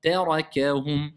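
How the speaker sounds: slow Tarakahum, light "tè", heavy "ra", light "kè"; measured Tarakahum with alternating vowel weight; thin, heavy, thin. Shown as a demonstration. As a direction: recite Tarakahum slowly, darkening only the ra. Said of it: "une habitude à prendre".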